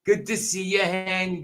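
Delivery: hammed-up Glaswegian, calling out cheerily; booming Glaswegian hello to the viewers; Glaswegian accent. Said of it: "Talking like a Glaswegian here".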